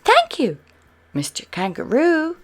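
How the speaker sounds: high, loud call of thanks; shouted thanks. A narrator's reading. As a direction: shout your thanks after him across the field. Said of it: "shouted Spot".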